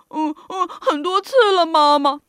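put-on loud, childish blubbering; hammed-up tearful admission to mum; giant whimpering. A narrator's reading. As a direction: sob like a scolded little boy. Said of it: "巨人呜咽着说".

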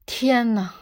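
ordinary delivery, nothing marked

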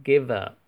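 "give up" run together as one word, givup; linked give up. Shown as a demonstration. Teacher showing students the linking, run together. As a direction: link the v straight into "up". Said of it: "它的读音是连读".

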